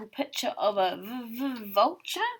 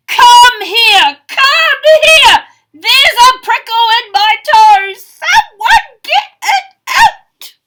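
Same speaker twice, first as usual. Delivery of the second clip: desperate howling yell, much higher and louder; 13 semitones higher, 22 dB louder, slower than usual. Acted. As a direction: howl for help in pain like a giant. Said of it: "The giant yelled and howled".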